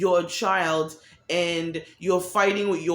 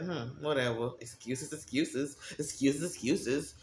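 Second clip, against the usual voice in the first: dismissive mutter; 6 semitones lower, 10 dB quieter than usual. Retold or imitated. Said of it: "I was like".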